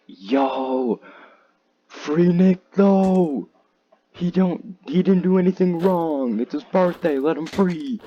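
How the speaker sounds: high, loud pleading for his friend. Retold or imitated. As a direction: beg them to free Nick, voice up high.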